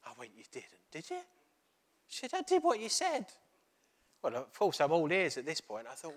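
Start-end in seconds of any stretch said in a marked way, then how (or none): 0.4-1.2 you didn't, did you, swooping up in disbelief; surprised disbelief. Retold or imitated. Incredulous reply to her news. 2.3-3.3 high, louder, bursting to tell; excited insistence. Retold or imitated. Wife telling the pastor her news, high and thrilled.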